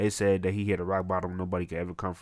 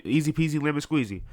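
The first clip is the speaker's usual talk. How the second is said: breezy confidence; 7 semitones higher than usual. Acted. Julian acting confident before task.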